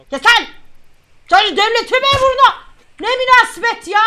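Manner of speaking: high, loud mock outrage; indignant shouting. Officer offended by a bribe offer, indignant shouting.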